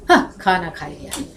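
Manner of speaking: low-pitched answer, "हाँ" set off before "खाना खा लिया"; meaning by intonation. Shown as a demonstration. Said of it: "देखो कितने अर्थ हो गए".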